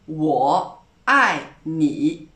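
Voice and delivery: 我爱你 said very slowly, dipping 我, falling 爱, dipping 你; slow model pronunciation. Shown as a demonstration. Teacher teaching the key Valentine phrase, slow and careful. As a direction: sound out 我爱你 slowly, syllable by syllable. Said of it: "La phrase magique".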